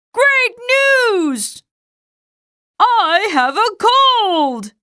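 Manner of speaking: high, bright shout of joy; overjoyed. Acted. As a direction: announce it with glee. Joyful announcement.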